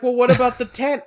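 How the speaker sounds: high, loud challenging boss; mock challenge. Boss challenging me about the tent, high and loud. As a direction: push back like the boss.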